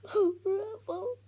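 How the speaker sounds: high, slow, tearful sob; crying. Acted. Tom cries into his dog's fur. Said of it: "he cries".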